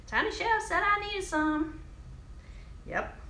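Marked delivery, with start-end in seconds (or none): 0.1-1.8 much higher, silly shopper voice; squeaky pretend customer asking at the counter; mock shopper voice. Imagining a viewer at Whole Foods, high mock voice. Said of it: "You gonna roll up in the Whole Foods like".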